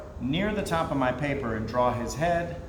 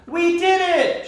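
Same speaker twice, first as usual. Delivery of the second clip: very high, loud, slow cheer; triumphant shout; 7 dB louder than usual. Acted. Creatures shouting after scaring the moon dog off. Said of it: "shouted the night creatures".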